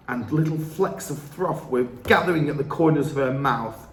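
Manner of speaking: plain reading voice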